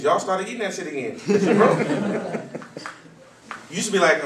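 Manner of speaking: ordinary talk, nothing marked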